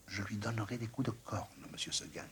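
barely voiced, soft and stubborn; whispering little goat. A narrator's reading. Blanquette telling her master she wants the mountain, whispered. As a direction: breathe the wish in a whisper.

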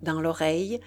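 plain reading voice

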